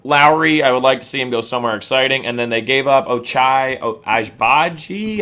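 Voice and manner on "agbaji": Agbaji said slowly, each syllable spelled out; careful pronunciation. Shown as a demonstration. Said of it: "I wanna say is the way you pronounce it".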